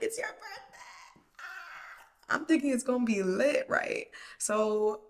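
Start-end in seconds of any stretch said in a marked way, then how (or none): 0.0-2.3 very high, soft, drawn-out squeal; mock birthday excitement. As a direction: coo it slowly in a tiny put-on voice. Acting delighted at his birthday.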